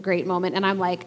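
ordinary talk

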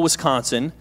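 ordinary delivery

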